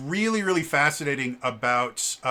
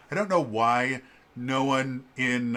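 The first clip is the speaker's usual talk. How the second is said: ordinary delivery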